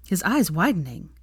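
ordinary delivery

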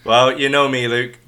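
usual speaking voice

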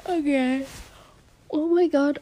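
talking through a yawn; stretched yawning words. Real, not acted. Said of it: "I'm so sorry about my yawning right now".

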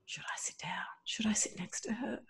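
unvoiced, anxious whisper; nervous whispered inner question; whispered worry. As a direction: whisper it to yourself, unsure. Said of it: "And I'm like".

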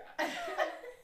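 high-pitched giggling burst; real laughter. Real, not acted. Stumped by the brown eyes answer, high laughter.

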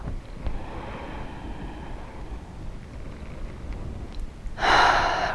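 slow breathy sigh out; long audible sigh. Shown as a demonstration. Breathing exercise, exhale.

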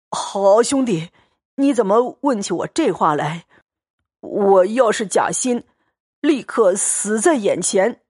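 put-on boisterous, fawning drunk bully, laughing as he talks; a tipsy braggart wheedling his friend to stay; drunken Xue Pan. Drunk Xue Pan grabs Xianglian and begs him to stay, boisterous and fawning.

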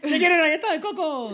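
mock vendor. Vendor caricature.

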